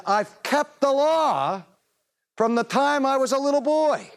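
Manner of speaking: mock his boast in a lofty high voice; mocking self-righteous claim. Preacher mimics the young man to the congregation, mocking and high.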